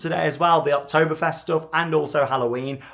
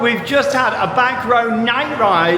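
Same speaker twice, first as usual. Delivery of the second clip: excited; 6 semitones higher, 3 dB louder, slower than usual. Real, not acted. Just off the night coaster ride.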